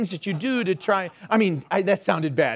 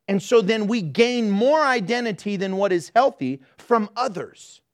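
usual speaking voice

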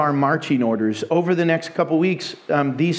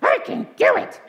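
very high squeal of belief; triumphant piglet puppet. Acted. Coached puppet finally believing, triumphant high squeal. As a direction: squeak it out like you finally mean it. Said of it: "she would coach her until finally Pinky".

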